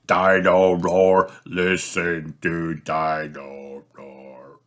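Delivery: slowing and fading toy song; batteries dying. Acted. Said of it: "stops walking and talking completely".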